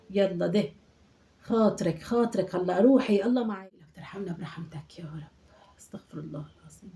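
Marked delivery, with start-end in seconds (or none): 3.7-6.9 breathy unvoiced plea to God; whispered prayer. Old woman alone after the call, whispered prayer. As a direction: murmur it to yourself.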